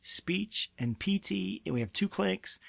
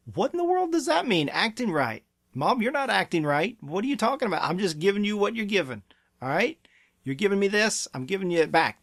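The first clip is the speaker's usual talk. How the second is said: pitched-up, sassy protest; child's back talk; 3 semitones higher than usual. Acted. Child answering a parent who said to act right, sassy back talk. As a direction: throw the parent's rough tone straight back. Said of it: "was the same attitude".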